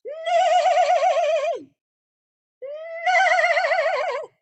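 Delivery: high, loud whinnying neigh; whinny it out like a horse. Shown as a demonstration. Showing the kids a horse's high neigh. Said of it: "Or maybe a horse".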